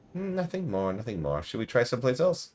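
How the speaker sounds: put-on high, muttering old seamstress, sing-song; croaky old seamstress. Player asks who she is, old seamstress answers in high mutter. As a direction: play Marta as a batty crone. Voices Marta.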